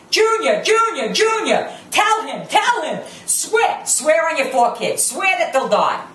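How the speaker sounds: JUNIOR hammered three times, high and falling each time; repeating in threes. Retold or imitated. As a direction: mimic her insistent "Junior, Junior, Junior", each a separate high jab. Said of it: "she says things in threes".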